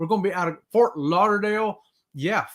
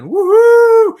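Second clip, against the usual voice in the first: loud, high whoop; woohoo cheer; 14 dB louder than usual. Real, not acted. Host cheering a viewer's greeting, loud high whoop. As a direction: let out a big celebratory whoop. Cheering Jen's cruise countdown.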